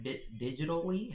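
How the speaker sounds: "Di-, digitally?" stammered on the first syllable, then rising; stumbling repeat. Real, not acted. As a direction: trip over "digitally" and ask it back. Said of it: "I almost tripped over that word".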